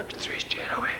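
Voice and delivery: breathy whispered repeat. Shown as a demonstration. Retelling the tiger act to the audience, whispered. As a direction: hush it like the tent went silent.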